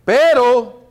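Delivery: "Pero" loud and high, falling; stress on PERO. Shown as a demonstration. Preacher tells congregation to circle the word, loud stressed PERO. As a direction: punch "Pero" hard. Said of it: "circule eso".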